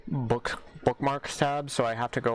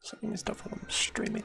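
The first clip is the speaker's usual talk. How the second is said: soft unvoiced whisper; 10 semitones higher, 5 dB quieter than usual. Real, not acted. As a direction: whisper it as a private aside. Whispered aside on stream.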